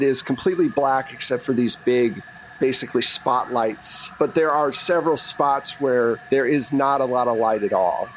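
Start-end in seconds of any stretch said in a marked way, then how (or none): none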